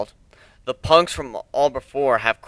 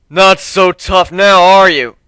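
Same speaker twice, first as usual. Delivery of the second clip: loud, slow, higher gloating taunt; gloating bully; 6 semitones higher, 13 dB louder, slower than usual. Acted. Spiky-haired bully gloating over the narrator, loud slow taunt.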